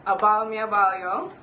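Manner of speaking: "A bal me, a bal yo" in a Jamaican Patois lilt; patois attempt. Shown as a demonstration. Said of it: "try it again in patois".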